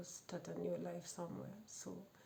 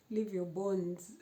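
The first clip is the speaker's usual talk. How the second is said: usual speaking voice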